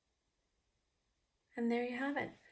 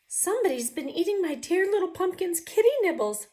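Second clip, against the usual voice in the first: much higher, louder mommy voice; 10 semitones higher, 8 dB louder than usual. Acted. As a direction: fuss over it as the mommy. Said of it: "said the mommy person".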